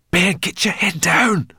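ordinary delivery

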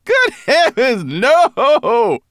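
high, loud, laughing denial; hearty amusement. A narrator's reading. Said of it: "Berber Chan laughed so heartily".

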